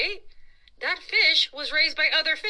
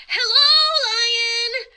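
high, loud eager greeting; loud hello; 10 semitones higher, 9 dB louder, much slower than usual. Acted. Boy loudly greeting a lion, in a high loud hello. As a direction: call out brightly to the lion. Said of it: "He said".

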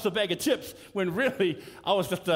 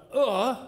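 ugh; 6 semitones higher, 5 dB louder than usual. Retold or imitated. Apathy sound, mock groan.

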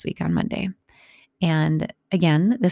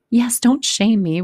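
higher, louder, slow protest; defensive daughter; 5 semitones higher, 6 dB louder, slower than usual. Retold or imitated. Daughter defends turning down the lead, higher, louder, slow. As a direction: brace against judgment. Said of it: "great reflection on my parenting".

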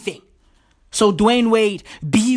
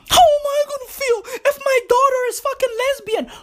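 hammed-up high wail; mock distressed parent; 14 semitones higher, faster than usual. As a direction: play the fretting parent, very high-pitched. Mocks a self-centred worried parent.

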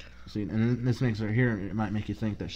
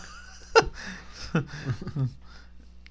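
breathy laugh; 5 semitones higher than usual. Real, not acted. Laughing at the scene.

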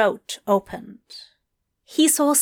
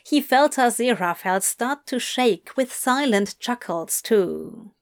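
ordinary delivery

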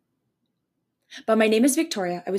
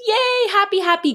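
very high, squealed yay; high, hammed-up cheer; mock childish joy; 11 semitones higher than usual. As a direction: play a delighted little kid. Mocking childish good feelings.

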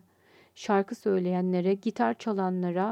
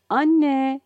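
higher, louder call for mother; 4 semitones higher, 8 dB louder than usual. A narrator's reading. Child calls mother on coming home, calling out. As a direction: call out loudly, pitch raised. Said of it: "diye seslendi".

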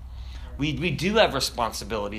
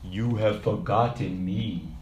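deep, slow, solemn voice from the sky; low and drawn-out, a ghostly father calling down; Mufasa; 5 semitones lower than usual. Acted. Ghost father calling to his son from the sky, deep and solemn. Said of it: "Mufasa's voice, or James Earl Jones' voice".

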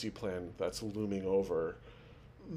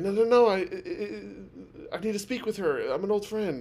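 much higher, louder pleading man; desperate plea; 9 semitones higher, 4 dB louder than usual. Retold or imitated. Turned-away visitor pleads with staff to see her, much higher and urgent. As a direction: beg to be let in, voice jumping up. Guy pleads after being refused.

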